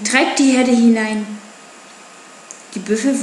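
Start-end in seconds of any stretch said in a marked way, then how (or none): none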